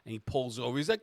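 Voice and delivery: usual speaking voice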